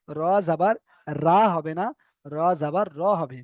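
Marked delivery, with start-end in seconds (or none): none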